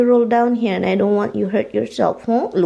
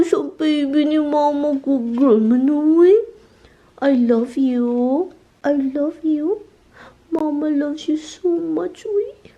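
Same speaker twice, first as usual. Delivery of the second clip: tender to pet; 4 semitones higher, slower than usual. Real, not acted. Owner saying goodbye to her dog, slow and tender. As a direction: linger on each word like a goodbye hug.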